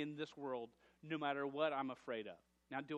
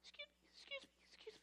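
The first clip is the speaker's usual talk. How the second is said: tiny high-pitched timid voice, much softer; timid squeak; 17 dB quieter, faster than usual. Retold or imitated. Pastor imitating his assistant trying to get a worker's attention, tiny timid squeak. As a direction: squeak it out like a shy girl afraid to interrupt. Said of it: "I see Bree going".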